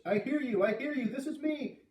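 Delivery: high, eager cry into the radio; urgent excitement. Acted. Boy finally answering a voice on the radio, urgent and excited. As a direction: answer desperately, pitch leaping up.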